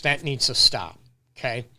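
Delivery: ordinary delivery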